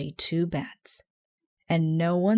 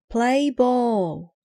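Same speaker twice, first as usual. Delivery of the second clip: high, loud play ball; umpire's call; 7 semitones higher, 6 dB louder than usual. A narrator's reading. Old Casey opening the game, a loud umpire call. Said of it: "called old Casey".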